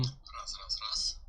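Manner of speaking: unvoiced whispered mic check; whispering. Shown as a demonstration. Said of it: "mic check whispered".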